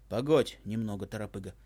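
lightly voiced old man. A narrator's reading. Old man calms grandson. (низкий голос старика)

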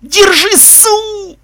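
very high, loud howl cut short; frantic high-pitched howl of a command; howling yell. A narrator's reading. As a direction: yell for help, stretching into a wail. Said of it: "завыл любитель тёплых".

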